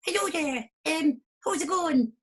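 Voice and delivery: high, slow cutesy flower voice; squeaky little flower hello. Acted. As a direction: greet like a tiny shy flower. First flower character speaks.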